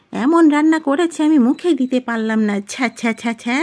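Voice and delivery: high, aggrieved mother's harangue; complaining mother-in-law. Acted. Mother running down daughter-in-law to her son, aggrieved. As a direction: whine indignantly at a high pitch, ending in disgust.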